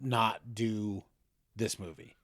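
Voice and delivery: reluctant executives. Retold or imitated. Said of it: "they're like".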